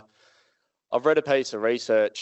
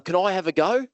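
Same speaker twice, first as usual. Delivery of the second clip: put-on raised, eager kid's voice; 7 semitones higher than usual. A little boy asks the speaker to try his cane. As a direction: ask keenly like a little boy.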